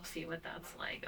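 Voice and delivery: breathy whispered aside. Real, not acted. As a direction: whisper it to yourself.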